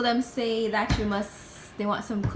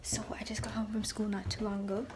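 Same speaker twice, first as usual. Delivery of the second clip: whispering; 17 dB quieter than usual. Real, not acted. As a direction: hush it, whisper every word. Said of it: "I'm currently whispering because".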